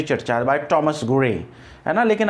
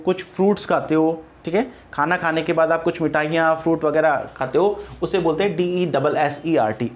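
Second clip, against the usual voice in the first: ordinary delivery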